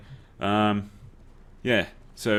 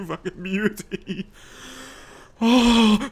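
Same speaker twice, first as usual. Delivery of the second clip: drawn-out high cheer; slow gloating cheer; 12 semitones higher, 6 dB louder, much slower than usual. Real, not acted. Celebrating game win.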